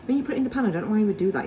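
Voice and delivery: ordinary delivery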